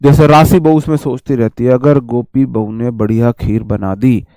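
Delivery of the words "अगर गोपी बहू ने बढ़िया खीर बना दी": scheming inner thought. Retold or imitated. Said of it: "वो ऐसे सोचती है".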